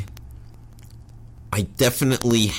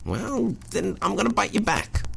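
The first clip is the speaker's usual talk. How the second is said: very high, softer mock threat; timid comeback; 11 semitones higher, 4 dB quieter than usual. Man answers the dog's bite threat, timid high comeback. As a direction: threaten back in a small high voice.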